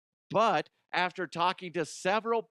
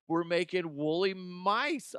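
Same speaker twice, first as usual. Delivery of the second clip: ordinary delivery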